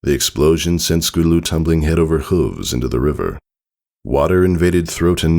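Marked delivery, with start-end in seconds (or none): none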